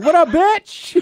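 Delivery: high-pitched, brash phone greeting; imitated ex answering. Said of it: "she answers like".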